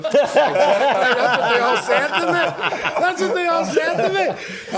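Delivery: loud, high, drawn-out laughing words; helpless high-pitched laughter through the words; laughing hard. Real, not acted. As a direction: crack up while saying it. Cracking up at friend's reaction.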